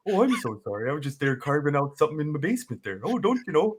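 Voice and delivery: lightly Minnesota-ish apologetic voice; Fargo accent. Acted. Playing Ed Gein to the officer, light Fargo accent. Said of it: "a fun Fargo".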